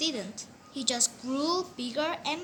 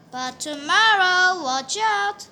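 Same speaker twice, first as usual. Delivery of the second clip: mock threat; 4 semitones higher, 9 dB louder than usual. A narrator's reading. Ominous closing line.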